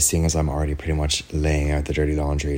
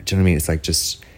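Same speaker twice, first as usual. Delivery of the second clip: ordinary talk, nothing marked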